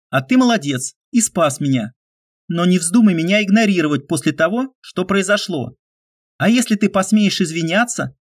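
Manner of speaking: half-joking threat, self-deprecating; young woman's voice. A narrator's reading. Olga's long retort.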